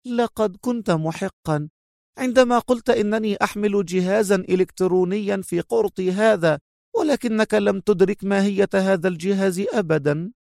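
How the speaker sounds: high-pitched smiling condescension; mocking. A narrator's reading. Captive woman revealing her earring's secret to her captor, mocking. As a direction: explain it high and smug, with a smile. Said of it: "مجيبة بنفس الابتسامة الساخرة".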